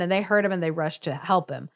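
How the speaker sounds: ordinary delivery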